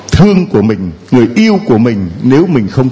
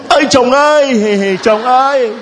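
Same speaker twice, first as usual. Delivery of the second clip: mock wife calling; 9 semitones higher than usual. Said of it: "Lên bảo kiếm chồng đi cứ".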